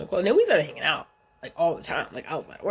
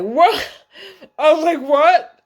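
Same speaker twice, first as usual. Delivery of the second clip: loud, high retold shock; shocked what; 10 semitones higher, 10 dB louder, slower than usual. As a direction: blurt it out, caught completely off guard.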